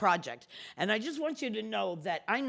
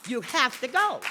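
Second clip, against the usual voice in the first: louder insistent repeat; 5 semitones higher, 7 dB louder than usual. Real, not acted.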